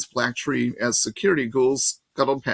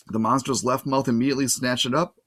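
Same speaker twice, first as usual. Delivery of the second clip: usual speaking voice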